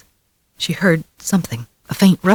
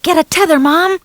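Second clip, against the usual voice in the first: put-on high, loud boy's yell; boy yelling to his mother; 4 dB louder than usual. A narrator's reading. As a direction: shout it back to Mom. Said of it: "he yelled".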